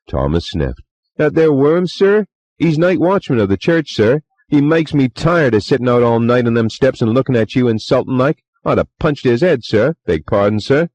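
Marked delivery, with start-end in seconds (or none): 1.1-10.9 higher, faster, louder servant voice; chatty young bellboy, eager to please; Cockney bellboy. A narrator's reading.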